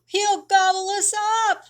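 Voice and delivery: high, loud, squeaky terror; wolf friends squealing in terror; panicked squeal. Acted. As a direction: squeal in fright at being eaten. Wolves fear the alligator.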